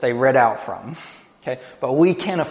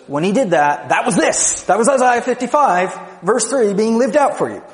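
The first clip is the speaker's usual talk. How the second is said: Paul preaching, higher and louder; Paul's excited proclamation; 7 semitones higher, 4 dB louder than usual. Retold or imitated. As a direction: announce the prophecy fulfilled with rising excitement. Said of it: "He says".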